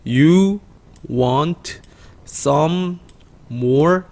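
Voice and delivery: one word at a time, pause after each; spaced-out teaching delivery; word-by-word read-aloud. Shown as a demonstration. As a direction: model each word separately for learners. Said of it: "읽어볼게요".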